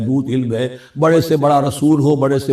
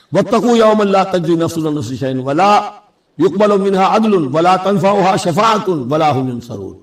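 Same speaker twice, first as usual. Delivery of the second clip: fast, higher-pitched Arabic recitation; Quran recitation; 4 semitones higher, faster than usual. Acted. Teacher citing the parallel verse to students, brisk recitation.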